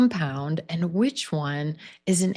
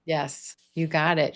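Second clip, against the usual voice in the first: usual speaking voice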